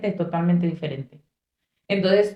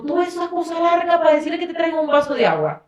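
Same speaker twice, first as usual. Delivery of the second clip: queja burlona aguda; 8 semitones higher, faster than usual. Retold or imitated. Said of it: "Y yo digo".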